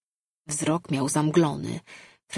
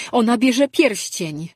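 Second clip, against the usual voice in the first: higher, louder and slow frightened cry; alarmed; 6 semitones higher, 8 dB louder than usual. A narrator's reading. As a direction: stress on "bierze", horrified. Diara alarmed, ring being taken.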